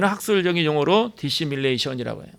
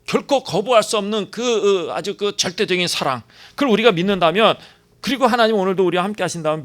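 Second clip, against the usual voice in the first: higher, louder fervent preaching; preaching at full force; 5 semitones higher, 5 dB louder than usual. Acted. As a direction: lift the congregation with rising fervour. Preaching climax on God's love.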